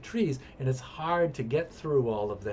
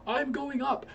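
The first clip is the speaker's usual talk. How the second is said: much higher hurried answer; 13 semitones higher than usual. Retold or imitated. Fleeing the bees, a much higher hurried answer. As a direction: blurt it while scrambling. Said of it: "retold reply in panic".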